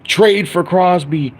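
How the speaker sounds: high, slow mocking hype-video voice; slow, high mock clickbait narrator; mocking clickbait YouTuber. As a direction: ham up a breathless clickbait host. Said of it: "making videos like".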